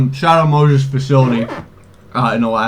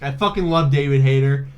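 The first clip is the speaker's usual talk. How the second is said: ordinary delivery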